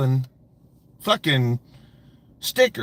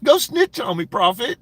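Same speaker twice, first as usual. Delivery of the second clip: mock taunt; 9 semitones higher than usual. Streamer mimics rival taunting the prophet. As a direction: sneer it like a coward daring someone. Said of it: "is Chris Ruff".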